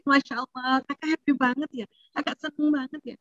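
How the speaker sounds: soft, tender, approving praise; a mother softly naming her teen's joy. Shown as a demonstration. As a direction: praise the child gently and softly. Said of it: "di sini kita ajarin".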